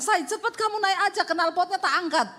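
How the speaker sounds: high, hurried urging; urgent call. Retold or imitated. Wife urging her husband to drive on, high urgent call. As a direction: rush him along.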